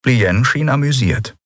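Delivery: usual speaking voice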